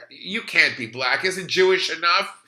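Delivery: loud, high imitation of the friend's mother; loud, high retold yell, slow at the start; mock outburst. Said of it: "she goes".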